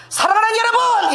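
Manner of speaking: high loud 사랑하는 여러분 shouted out; nervous shout. Retold or imitated. Preacher retelling her first subway sermon, shouted nervously. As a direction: blurt it out far too loud from nerves. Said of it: "얼마나 긴장하고 소리를 크게 내는지".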